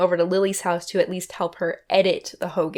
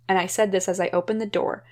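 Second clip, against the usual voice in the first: usual speaking voice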